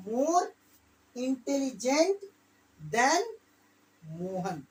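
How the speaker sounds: each word spaced out slowly; slow dictation. Shown as a demonstration. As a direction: dictate word by word for students to write. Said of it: "teacher dictating slowly".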